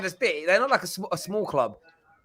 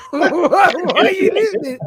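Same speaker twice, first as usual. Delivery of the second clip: high, loud laughing outburst; laughing incredulous question; laughing disbelief; 14 semitones higher, 9 dB louder than usual. Real, not acted. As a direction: crack up in disbelief. Mocking disbelief at caller.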